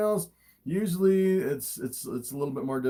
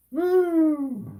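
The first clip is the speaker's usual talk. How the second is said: startled whoop; 12 semitones higher, 4 dB louder than usual. Real, not acted. Snake whips around.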